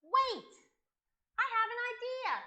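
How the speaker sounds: high, loud burst; excited realisation. Acted. Boy interrupting the sad dragons, excited exclamation. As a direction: burst out with sudden inspiration. Boy suddenly has an idea.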